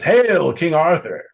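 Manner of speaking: high, loud herald's cry; rallying hail. Acted. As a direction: lead the cheer as a proud father. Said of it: "he raises his sword and he says".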